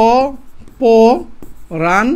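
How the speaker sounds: অ, প, রান each said alone with long pauses; slow, separated syllables for learners; piece-by-piece sounding out. Shown as a demonstration. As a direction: sound out the word one piece at a time. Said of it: "এটা বানানটা কিভাবে দেওয়া আছে".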